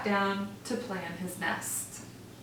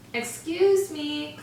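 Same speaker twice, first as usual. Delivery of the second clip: calling up; 7 semitones higher, slower than usual. Acted. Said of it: "called Livingston".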